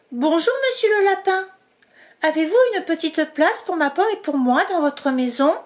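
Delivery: high, bright-eyed mouse voice asking politely; a tiny mouse asking a favour; small hopeful mouse voice; polite little mouse. Acted. Zazie speaking.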